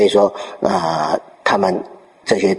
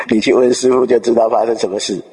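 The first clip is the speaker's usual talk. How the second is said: mock disciple chuckling through the words; laughing while speaking; 2 semitones higher than usual. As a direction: laugh as you repeat it, amused. Disciple laughs, repeats himself. (笑着说)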